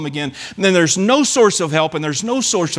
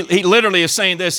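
ordinary talk, nothing marked

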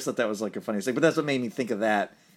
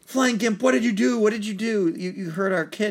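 much higher, louder mock scolding; hammed-up parents yelling at the Gimp; angry parents; 10 semitones higher, 4 dB louder than usual. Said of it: "They're like".

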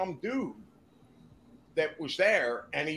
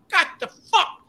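mock outrage; 6 dB louder than usual. Retelling outrage at hotel lobby.

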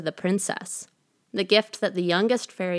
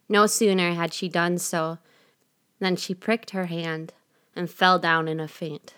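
ordinary delivery

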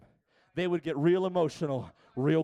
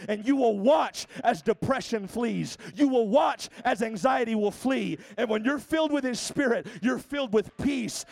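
raised, ringing promises to the congregation; full-force preaching; 5 semitones higher, 5 dB louder than usual. Real, not acted. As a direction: stretch the key phrases, preaching with fervour.

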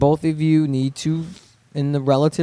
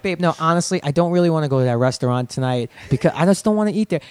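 put-on heated New York accent; 2 semitones higher than usual. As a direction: start sweet, snap into New York anger. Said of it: "when I get heated".

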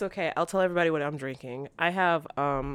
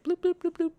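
playful bloop noises; 13 semitones higher than usual. Retold or imitated. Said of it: "I'm just like".

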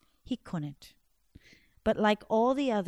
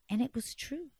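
ordinary delivery, nothing marked

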